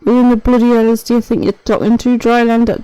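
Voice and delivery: put-on botched Scottish accent. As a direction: belt out a badly faked Scottish accent. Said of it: "I don't even know what that is".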